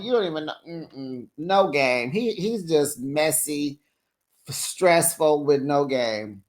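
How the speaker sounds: ordinary talk, nothing marked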